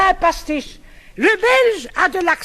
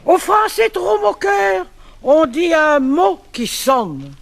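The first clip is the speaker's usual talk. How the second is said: poem recital; 5 semitones lower than usual. Acted. Recites verse pastiche on accent.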